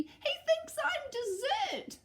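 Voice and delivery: very high, slow frightened wail; terrified child. Acted. Eddie to Mum, very high terrified wail. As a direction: plead in terror.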